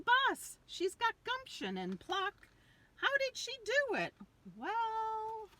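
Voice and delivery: high, bright questioning lilt; cheery high. A narrator's reading. Reading to kids, high bright teasing question. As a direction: lift up with delighted wonder.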